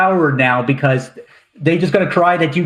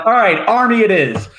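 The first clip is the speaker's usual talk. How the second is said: laughing; 7 semitones higher than usual. Retold or imitated. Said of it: "And I was like".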